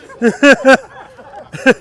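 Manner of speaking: hearty real laugh. Real, not acted. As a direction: laugh out loud at your own joke. Laughing at joke about Joe.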